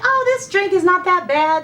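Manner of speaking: brightly brushing off the test drink; positive Patty. Retold or imitated. Patient upbeat to herself about the glucose drink, cheerfully confident. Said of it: "positive Patty".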